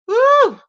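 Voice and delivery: loud high whoop. Real, not acted. Gossip host whooping at her own tea. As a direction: let out a big woo.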